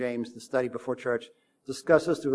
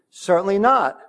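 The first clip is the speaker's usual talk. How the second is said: loud, raised-pitch emphatic refusal; 6 semitones higher, 6 dB louder than usual. Retold or imitated. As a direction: punch it out as a firm denial.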